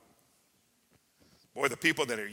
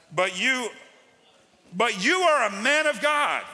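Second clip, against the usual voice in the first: raised, ringing proclamation; proclaiming; 6 semitones higher, 6 dB louder than usual. Acted. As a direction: call it out loud over the room. Preacher proclaims the verse.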